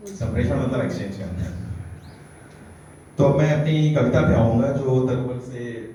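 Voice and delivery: ordinary talk, nothing marked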